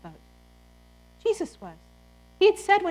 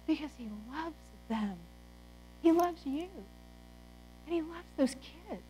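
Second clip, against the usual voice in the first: tender, soft; 2 semitones higher, 12 dB quieter than usual. Real, not acted. Tender emotional moment.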